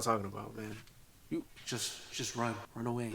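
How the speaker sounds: hushed whisper, as if hiding; scared little whisper. Real, not acted. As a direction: breathe it like a secret warning.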